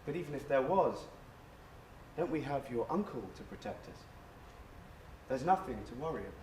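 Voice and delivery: low, soft reassurance; gently dismissing a bad dream; soothing grandmother. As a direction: comfort a frightened child, low and hushed. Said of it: "puts her arms around me".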